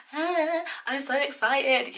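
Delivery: high, slow, loud beaming squeal; hammed-up excitement; mock excited gush. Shown as a demonstration. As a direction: gush with delight. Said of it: "It's very like".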